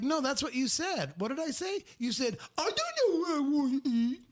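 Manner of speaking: high, squeaky argument mimicry; mocking spouse voice. Spouses fighting over what was said, high mocking voice. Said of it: "their voice, whether it's male or female, turns into".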